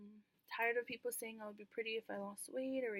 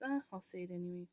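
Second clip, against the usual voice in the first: ordinary delivery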